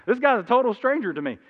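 ordinary delivery

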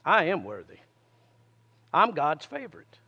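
low gentle confession of worth; confident child's confession. Retold or imitated. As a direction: say it softly like a trusting child. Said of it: "what a child would confess".

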